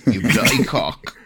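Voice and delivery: restrained British insult; put-on British, fairly level; British accent. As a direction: try the insult in mock British, held back. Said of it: "in a British accent".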